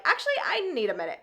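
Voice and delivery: quick, high-pitched mock excuse; jealous excuse. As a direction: imitate a jealous woman hurriedly bowing out. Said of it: "gets really jealous and is like".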